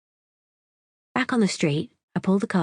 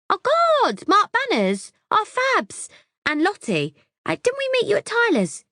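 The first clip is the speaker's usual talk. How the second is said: gushing airhead voice. A narrator's reading. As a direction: squeal like an excited ditz. Debs gushing.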